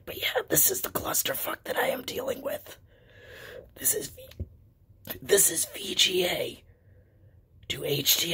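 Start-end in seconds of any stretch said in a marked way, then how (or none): 0.1-6.6 whispering with no voice; hoarse breathy whisper, voice gone; lost voice whisper. Real, not acted.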